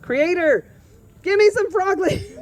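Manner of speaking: high, loud retold plea to the Creator; fierce mock prayer. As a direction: call out a hammed-up desperate prayer. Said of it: "if anybody ever fiercely prayed".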